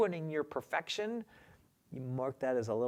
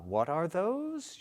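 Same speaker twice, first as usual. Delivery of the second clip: normal talking voice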